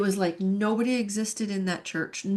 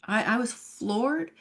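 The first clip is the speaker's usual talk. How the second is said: ordinary talk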